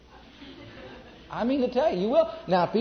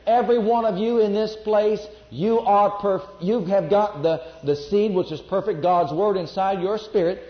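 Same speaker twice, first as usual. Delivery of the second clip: louder preacher's build, driving each phrase; full-force preaching; 4 semitones higher, 5 dB louder than usual. Acted. Preacher to congregation, full-force preaching.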